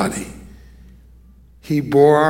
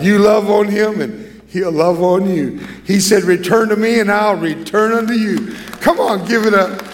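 rising preaching; 5 semitones higher than usual. Acted. Preaching climax, rallying congregation.

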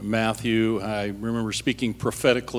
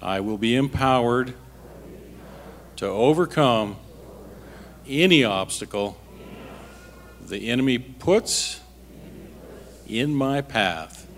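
earnest closing prayer voice. Real, not acted. Pastor praying before the congregation, earnest prayer.

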